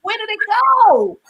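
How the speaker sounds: high, astonished question; stunned disbelief. Retold or imitated. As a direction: ask it as if the pain just vanished. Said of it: "I was like".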